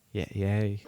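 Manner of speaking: flat, sarcastic yay; deadpan, no enthusiasm; unenthusiastic. Real, not acted. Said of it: "listen to that enthusiasm".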